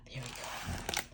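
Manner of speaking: soft whisper. Real, not acted. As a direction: breathe it quietly. Whispered aside to child.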